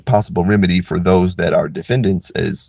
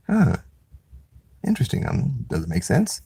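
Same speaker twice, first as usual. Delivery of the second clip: mock puzzled man; 7 dB quieter than usual. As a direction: play him dimly intrigued. Imitating the man's bafflement.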